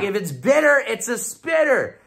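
high-pitched rhyming warning; exasperated scolding. Retold or imitated. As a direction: scold a friend with a catchy rule. Retold scolding of friend.

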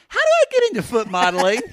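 high, fast, loud mock question; Poodle. Host imagining a friend's future reaction, high quick mimicry. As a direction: imitate an eager friend in a high voice. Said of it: "Poodle's gonna say".

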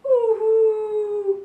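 high, drawn-out mock sob; hammed-up crying. Gecko answering Dinosaur about his tail, mock wailing. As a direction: wail it out like a cartoon. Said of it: "cried Gecko".

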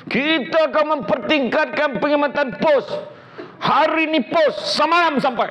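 high, slow, put-on voice of an old politician; Sami Velu impression. Quoting the late minister's famous slip, in a high mock voice. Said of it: "kata-kata Datuk Seri S. Sami Velu".

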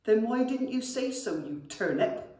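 scolding goblin. A narrator's reading. Goblin scolds his brother, cross and loud. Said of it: "said Seepage".

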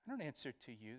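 mildly insolent acted retort; understated mock defiance; defiant. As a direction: refuse to answer, with a touch of cheek. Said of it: "there's a defiant tone".

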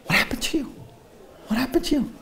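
high, stunned, questioning; father shocked by his son's new look. Retold or imitated. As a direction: gasp at how he changed.

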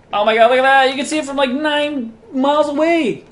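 high, loud exclaiming; excited amazement. Real, not acted. Gamer seeing it from nine miles away, excited shout.

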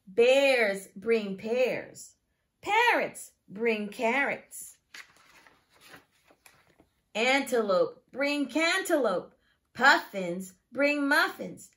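lively, singsong picture-book reading; reading to children. Acted. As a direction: read it to a class of small children. Teacher reads picture book aloud.

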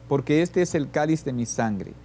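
usual speaking voice

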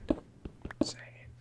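slow, very soft whisper; secretive whisper. Real, not acted. Player guarding a find from friend, slow whisper. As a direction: whisper it slowly.

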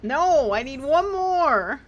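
high, loud, drawn-out complaint; real frustrated outcry. Real, not acted. Player still lacking one Manji item, frustrated outcry. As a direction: wail it out, stretching the last words.

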